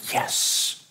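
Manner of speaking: soft, breathless whispered yes, yes; hushed excited yes. Retold or imitated. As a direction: whisper it like you can't contain the thrill. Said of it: "And we're like".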